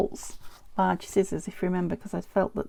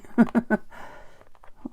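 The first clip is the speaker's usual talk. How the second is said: laugh; 3 semitones higher than usual. Real, not acted. Laughing at her glue problem.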